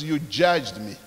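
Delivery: low, hushed, solemn voice speaking straight to the listener; voice from above. Retold or imitated. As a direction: quote an unseen voice's rebuke, soft and low, with long pauses. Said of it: "voice loud and clear".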